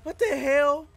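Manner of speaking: loud, high-pitched outburst; indignant yell. Real, not acted. Player just killed in the game, yelling indignantly. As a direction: cry out in disbelief.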